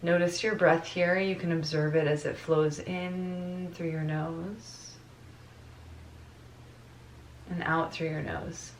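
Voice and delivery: soft meditation voice with long pauses; guided meditation. Real, not acted. Teacher guides class to notice their breath, guided meditation voice. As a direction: ease them into the breath, letting phrases fade softly.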